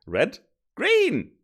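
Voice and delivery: high, loud excited shout of joy; happy yell. Real, not acted. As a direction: yell it out, thrilled it works. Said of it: "I don't know why I yelled that".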